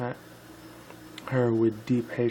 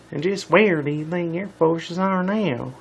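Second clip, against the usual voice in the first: higher, louder Southern cowgirl voice; spunky Southern pony, challenging; Applejack voice; 6 semitones higher, 6 dB louder than usual. Acted. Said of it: "And this is Applejack talking".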